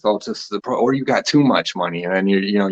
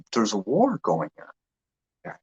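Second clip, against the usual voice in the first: ordinary talk, nothing marked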